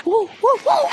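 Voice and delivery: high whooping "woo, woo"; mimicked whooping. Imitating her rowdy reaction.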